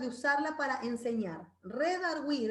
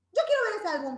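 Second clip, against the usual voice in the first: higher, louder pushy demand, acted; Feli; 8 semitones higher, 5 dB louder, faster than usual. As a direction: play someone barging in to grab it. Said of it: "Y viene Feli".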